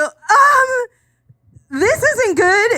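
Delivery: mock terrified squeak; panicked squeak. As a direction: caught red-handed, panicking. Caught by her mom.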